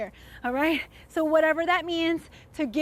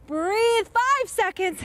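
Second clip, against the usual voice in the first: hyped coaching; 7 semitones higher, 5 dB louder, much slower than usual. Real, not acted. Coach pushing final seconds.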